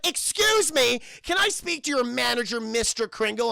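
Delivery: loud, high indignant customer; hammed-up entitled complaint; demanding Karen. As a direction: imitate a Karen demanding attention. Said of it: "She's the first Karen".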